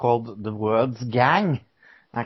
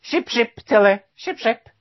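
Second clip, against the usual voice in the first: higher, clipped-sounding fake English toast; mock British cheer; 9 semitones higher, 3 dB louder than usual. Host sending a mock English cheer to sick Tilly, mock British accent. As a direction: toast her like a chummy Englishman. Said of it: "that's between us Englishmen".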